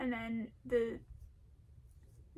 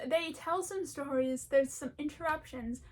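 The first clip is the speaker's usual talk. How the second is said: ordinary delivery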